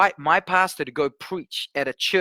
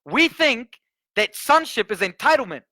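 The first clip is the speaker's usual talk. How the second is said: normal talking voice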